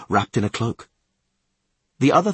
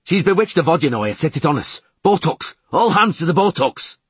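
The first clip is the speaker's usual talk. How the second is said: high, raised alarm call; urgent yell; 7 semitones higher, 4 dB louder than usual. A narrator's reading. Yelling to Podlorak and the deckhands, urgent high yell. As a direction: yell the warning and rally all hands. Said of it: "He yelled at Podlorak and the deckhands".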